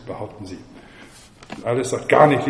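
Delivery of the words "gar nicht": loud indignant outburst. A narrator's reading. Alice objects to the twins' claim, indignant. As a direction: burst out with an affronted denial. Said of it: "rief Alice empört".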